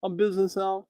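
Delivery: lightly voiced smug businessman; imitating business Helen. Podcaster quoting Helen's bit in a soft smug voice. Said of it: "Helen's the guy that says".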